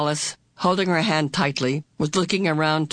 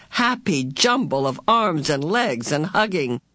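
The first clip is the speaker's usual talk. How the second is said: ordinary delivery, nothing marked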